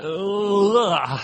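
a loud, pained ugh; exasperated groan. Acted. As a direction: groan as if the verse hurts to read. Preacher balking at the text.

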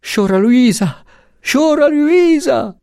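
distraught, high-pitched calling; anguished cry. A narrator's reading. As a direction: cry out in heartbreak, stretching the repeat. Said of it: "si gridò da vicino con accento di strazio".

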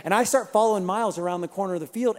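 usual speaking voice

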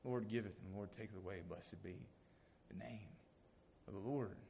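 soft, low, reverent quote; quietly surrender like Job; Job's hushed submission. Retold or imitated.

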